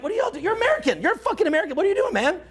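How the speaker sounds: high-pitched, baffled scolding of Americans; exasperated rant. Retold or imitated. Canadian outsider scolding Americans, exasperated high rant. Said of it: "And I'm like".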